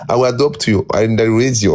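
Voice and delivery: ordinary talk, nothing marked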